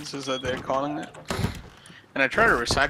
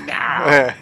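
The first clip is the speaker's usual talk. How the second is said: a touch of cat voice, loud; mock cat voice; 9 dB louder than usual. Voicing the cat answering back loudly. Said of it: "You can hear it say".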